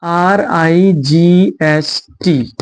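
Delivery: letters आर आई जी एच टी spelled out very slowly; slow spelling out. Shown as a demonstration. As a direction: spell each letter with long gaps. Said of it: "जैसे". (अक्षर धीरे-धीरे अलग अलग बोलें)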